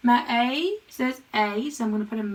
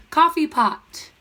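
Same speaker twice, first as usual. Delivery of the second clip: blended word; 5 semitones higher, 6 dB louder, slower than usual. Shown as a demonstration. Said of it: "let's sound it out together".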